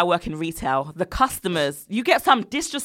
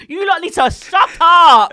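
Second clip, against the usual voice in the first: loud, high and drawn out; heated rant, stretching the words; exasperated; 9 semitones higher, 8 dB louder, slower than usual. Retold or imitated. Retail worker fed up with rude customers, exasperated.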